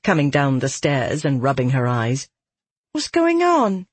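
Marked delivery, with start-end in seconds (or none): 2.9-3.9 put-on very high, drawn-out child's call; young girl. A narrator's reading. Girl coming downstairs rubbing her eyes, high child voice. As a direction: call out as a sleepy little daughter. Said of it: "a girl said".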